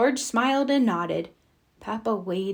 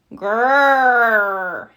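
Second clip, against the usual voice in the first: loud little dinosaur growl; 8 dB louder than usual. A narrator's reading. Little brother growling for his dinosaur, loud growl. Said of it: "said George".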